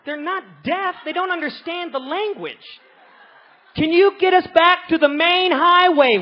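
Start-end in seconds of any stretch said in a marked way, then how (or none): 3.8-6.2 much louder, higher shout at locals; hammed-up tourist yelling; mock screaming. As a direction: bellow it as if they're deaf. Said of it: "Why are you screaming".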